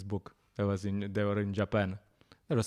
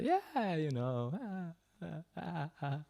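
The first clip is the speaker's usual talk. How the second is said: high, soft, noncommittal waffling; mock hedging, quiet and high; beating around the bush; 7 semitones higher, 8 dB quieter, slower than usual. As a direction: hem and haw evasively, fading into soft syllables. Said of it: "beat a bit around the bush".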